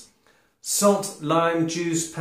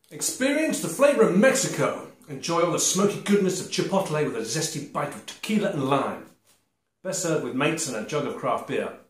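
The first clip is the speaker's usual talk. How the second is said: upbeat advert voice reading the packet; 4 dB louder than usual. Acted. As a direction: sell it like a radio ad. Reads the packet blurb aloud.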